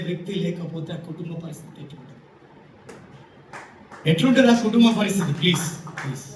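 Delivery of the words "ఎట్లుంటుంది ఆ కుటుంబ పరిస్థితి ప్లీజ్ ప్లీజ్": impassioned appeal. Real, not acted. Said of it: "preacher pleads with audience".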